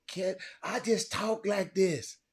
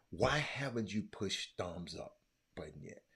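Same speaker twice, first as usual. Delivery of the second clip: whisper; 11 semitones lower, 14 dB quieter than usual. Real, not acted. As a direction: hush it like a secret plea. Pleading whisper to viewers.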